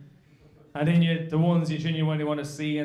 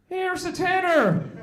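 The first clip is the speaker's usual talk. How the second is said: much higher, slow grudging handover; grudging farmer; 12 semitones higher, 4 dB louder, slower than usual. Retold or imitated. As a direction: hand it over reluctantly. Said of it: "pulls out".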